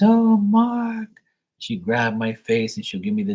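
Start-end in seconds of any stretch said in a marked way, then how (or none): 0.0-1.1 put-on high sweet old auntie voice; aunt's voice. Imitating his aunt greeting him fondly. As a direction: coo his name like a fond old aunt.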